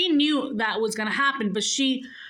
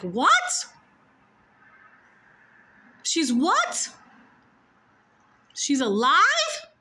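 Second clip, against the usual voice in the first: can't believe what she just heard; shocked disbelief; 5 semitones higher, slower than usual. Retold or imitated. Daughter to dying father, shocked disbelief.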